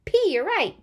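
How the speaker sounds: ordinary talk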